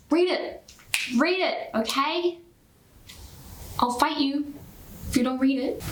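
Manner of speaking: playful insistence. Pretend. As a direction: order them to read it, playfully menacing. Playfully ordering viewers to read the book.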